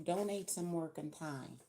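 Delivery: ordinary talk, nothing marked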